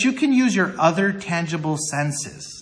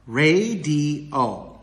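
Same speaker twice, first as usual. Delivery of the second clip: slow ra-di-o, each syllable drawn out; radio syllable by syllable. Shown as a demonstration. Teacher putting example words together, radio in slow syllables. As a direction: stretch radio into three separate beats. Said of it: "let's put those words together".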